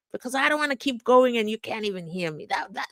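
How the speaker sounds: ordinary talk, nothing marked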